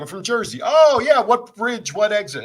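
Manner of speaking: high, loud mock Jersey greeting; imitating a Jersey exchange. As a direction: play the excited Jersey native. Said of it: "Where are you from?".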